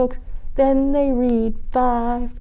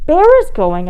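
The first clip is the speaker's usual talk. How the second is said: high, louder call; 6 semitones higher, 7 dB louder than usual. A narrator's reading. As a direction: call across the room. Mother calling child.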